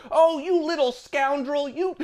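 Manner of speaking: put-on high, prim scolding of a naughty child; fussy old lady. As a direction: scold the flower thief in a shrill granny voice. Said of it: "This is not just like".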